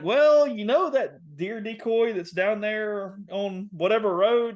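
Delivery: high, loud, drawn-out reluctant explaining; hesitant confession. Retold or imitated. Son breaking the news to dad, hesitant confession.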